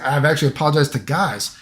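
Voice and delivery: usual speaking voice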